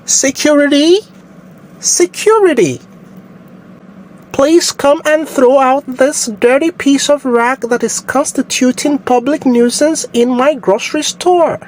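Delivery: high, posh-put-on British voice calling security; haughty fake English accent, pitched far up. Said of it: "your fake U K accent".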